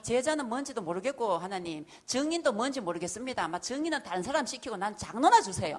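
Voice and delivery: loud pleading, almost shouting; demanding prayer. Retold or imitated. Believer demanding eldership from God, loud and pleading. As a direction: bargain hard with God.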